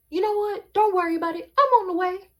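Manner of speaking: high, loud mock outrage; imitating furious grandma. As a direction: act out a grandma losing it on the phone. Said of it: "losing her mind on me on my phone".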